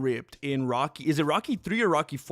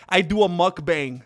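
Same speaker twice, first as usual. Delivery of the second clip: ordinary talk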